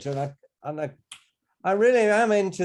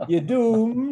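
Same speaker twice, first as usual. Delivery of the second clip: higher, louder prophet of doom; drawn out like a gloomy doomsayer; slow ominous drawl; 8 semitones higher, 5 dB louder than usual. Acted. Running joke, doom catchphrase echoed.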